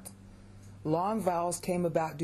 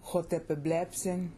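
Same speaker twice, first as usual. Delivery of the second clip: Hotepeblepsin stressed on the verb stem "blep". Shown as a demonstration. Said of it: "because it's blepo".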